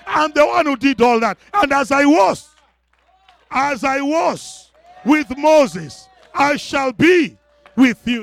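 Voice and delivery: loud, high preacher's declamation, building; thundering God. Acted. God correcting Joshua about who did the miracles, thundering. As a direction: proclaim it forcefully, hammering each claim. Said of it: "And God is saying".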